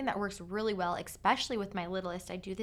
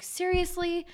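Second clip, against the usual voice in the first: exasperated; 10 semitones higher, 3 dB louder than usual. Retold or imitated. Exasperated mom at spilled mess.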